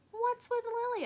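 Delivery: a mother asking, baffled; puzzled mother. Acted. Mother voiced in story.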